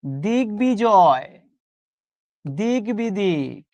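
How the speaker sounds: দিগ্বিজয় and দিগ্বিদিক said very slowly, sound by sound; slow teacher drill; slow pronunciation demo. Shown as a demonstration. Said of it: "এইভাবে উচ্চারণ করেন".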